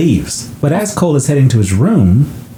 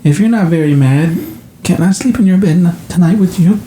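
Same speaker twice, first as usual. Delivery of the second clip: put-on child voice; 6 semitones higher than usual. Cole asks to sleep in bed.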